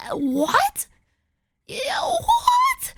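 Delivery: high, squeaky incredulous what; exaggerated bewildered questioning; baffled disbelief. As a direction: swoop way up in pitch on what. Repeats their baffled reaction.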